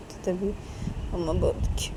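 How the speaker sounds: usual speaking voice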